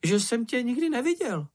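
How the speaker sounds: high, squeaky challenge; doubting sidekick. A narrator's reading. Čenich challenging Mumínek's boast, high squeaky voice. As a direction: needle him in a high, piping voice. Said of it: "ozval se Čenich".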